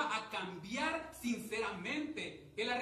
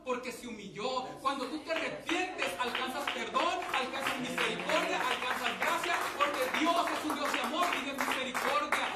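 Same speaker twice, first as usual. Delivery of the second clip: louder and louder, hammering each phrase; full-force pulpit preaching; fiery preaching; 2 semitones higher, 6 dB louder than usual. Acted. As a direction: preach it out to the congregation with rising force.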